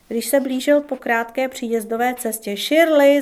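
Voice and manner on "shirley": loud call across the yard. A narrator's reading. Tommy calling his wife from the car, loud call. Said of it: "zavolal na ní".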